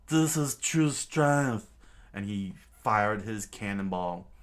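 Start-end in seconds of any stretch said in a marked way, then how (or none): 0.1-1.7 loud, slow, higher boast; slow triumphant villain roar. Acted. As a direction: bellow it slowly like a tyrant. Villain boasts firing cannon.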